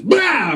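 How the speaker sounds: sudden booming mock sneeze; imitated explosive sneeze; big manly sneeze. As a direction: let out a showy, unrestrained sneeze. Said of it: "It's just like when a man sneezes".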